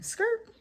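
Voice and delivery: high squealed skrrt; tire screech. Retold or imitated. Telling viewers about switching lanes, high skrrt. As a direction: squeal it like tires peeling off. Imitating car speeding into lane.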